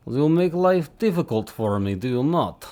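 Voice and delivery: playful fake Russian accent. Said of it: "I speak in fake Russian accent".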